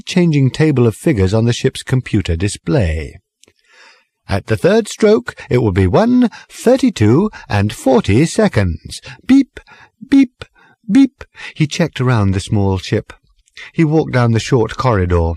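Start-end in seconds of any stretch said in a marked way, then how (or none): 4.3-11.2 high, stilted sing-song recorded voice; speaking clock. Acted. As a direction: sing the time like an old talking clock. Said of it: "odd, rather stilted sing-song voice".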